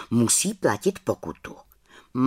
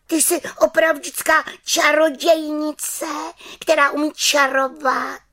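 put-on high, louder amazed child; girl's voice; 4 dB louder than usual. Girl marvels at a real witch, high voice.

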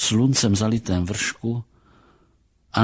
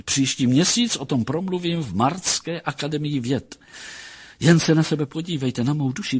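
higher, lively acted voice of a marvelling doctor; excited academic admiration, pitched up; enraptured psychologist; 7 semitones higher than usual. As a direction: gush like a scholar over a prize case. Psychologist exults over his patient.

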